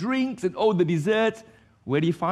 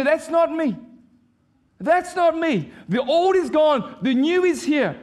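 bold declaration; 10 semitones higher than usual. Pretend. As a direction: declare it boldly over the old self. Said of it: "you speak back and you say".

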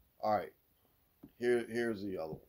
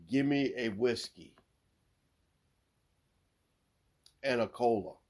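long silence between "whiskey" and "and a cola"; big pause. Acted. Bear ordering at a bar, long pause before "and a cola". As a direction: stop dead after "whiskey", then add "and a cola". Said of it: "Why the big pause?".

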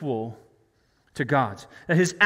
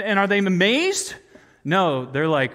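ordinary talk, nothing marked

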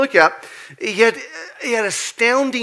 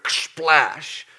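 a hammed-up spoken splash, like water; mock splash sound effect; 4 semitones lower than usual. Preacher voicing a splash for the congregation, mock sound effect. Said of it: "What'd y'all think of the sound effects".

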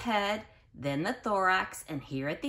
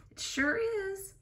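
high, bright-eyed it sure is; excited squeal; 14 semitones higher than usual. Acted. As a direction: squeal the answer to the kids. Teacher answers kids excitedly.

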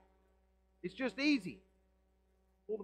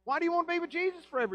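much higher, very fast disbelief; incredulous retort; 12 semitones higher, 4 dB louder, much faster than usual. Acted. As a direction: fire it back in disbelief. Preacher fires back incredulously.

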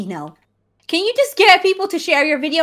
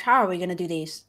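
usual speaking voice

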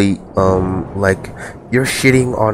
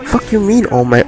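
outraged retort; 11 semitones higher than usual. Retold or imitated. Snapping back at the kids on Discord, squealing in outrage. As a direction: shriek it in mock outrage. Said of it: "I'm like".

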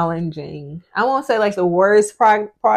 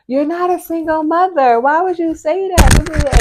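high, louder scolding imitation of critics; mock upset; 9 semitones higher, 6 dB louder than usual. Mother mimicking people upset by her single mommy remark, mock upset. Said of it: "People kinda was upset because they were like".

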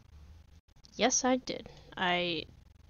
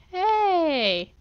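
indignant shout; 9 semitones higher, 7 dB louder than usual. Real, not acted. Intruder got in again.

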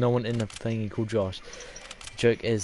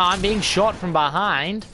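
higher, louder, drawn-out mock Australian; Australian accent; 7 semitones higher, 5 dB louder, slower than usual. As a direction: put on an Australian accent under fire. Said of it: "doing this in an Australian accent".

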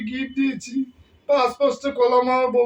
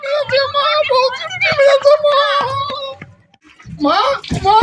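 put-on childish wailing; 13 semitones higher, 5 dB louder than usual. Son wails to his mother that his wife pinched him, childish high wail. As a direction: cry out like a hurt child.

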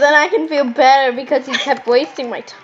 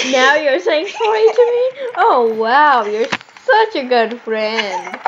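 mock-offended sarcasm; slower than usual. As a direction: act hurt, then drag out the fake praise. Sarcastic scolding, then says joking.

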